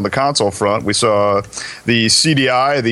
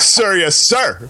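snappy mock soldier reply, higher pitch; nervous eager young recruit; 6 semitones higher, much slower than usual. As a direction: answer like a keen new recruit. Mock military reply to rebuke.

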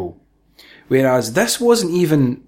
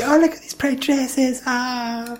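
much higher, airy mock woman admiring dresses; mock girly voice; 12 semitones higher than usual. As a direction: ham up a giddy woman fawning over dresses. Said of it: "she does say".

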